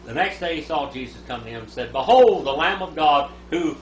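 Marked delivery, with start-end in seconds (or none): none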